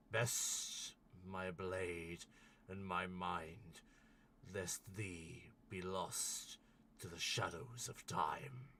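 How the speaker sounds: booming opening, then slow, weighty pronouncement; solemn giant guardian. Acted. Ice giant guardian warns those seeking entry, slow and solemn. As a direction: intone an ancient warning with long pauses.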